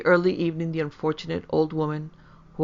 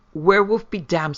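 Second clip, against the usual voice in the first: raised, forceful angry retort; choking with rage; 3 semitones higher, 7 dB louder than usual. A narrator's reading. Lecouteur rebuts the superstitious villagers, choking with rage. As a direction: snap it out in a loud, narrated rage. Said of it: "choking with rage".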